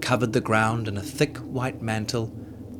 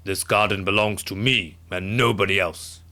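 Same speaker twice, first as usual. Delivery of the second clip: giant mumbling; 2 semitones lower than usual. A narrator's reading. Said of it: "the giant mumbled to himself".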